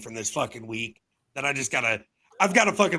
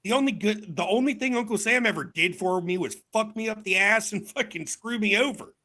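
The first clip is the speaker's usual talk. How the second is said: mock rant; 7 semitones higher than usual. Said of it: "I'm like".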